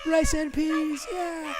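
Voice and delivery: hammed-up shout of rice and peas; mock excited shout. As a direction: belt it out like a nervous performer. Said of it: "I was like".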